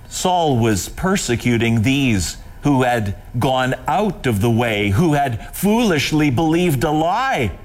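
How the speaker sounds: ordinary talk, nothing marked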